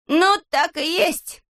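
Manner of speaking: high, very drawn-out exclamation; exasperated "I knew it" exclamation; exclaiming. A narrator's reading. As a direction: cry out slowly at a high pitch, suspicion confirmed. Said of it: "воскликнул Незнайка".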